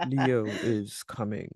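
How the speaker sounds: low, slow rumble broken by a laugh; Mufasa voice. Acted. Co-host repeats the Mufasa line, low and laughing. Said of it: "I tried to get that good rumble in there".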